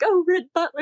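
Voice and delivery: high-pitched mock Southern belle accent. Caller imitating Gone with the Wind, mock Southern belle accent. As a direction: swoon it in a put-on Southern voice. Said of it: "like, Gone with the Wind".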